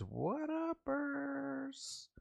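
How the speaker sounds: high, very slow stretched greeting; drawn-out greeting. Real, not acted. Host greeting Luna from OG Foods, high drawn-out hail. Shout-out to a viewer.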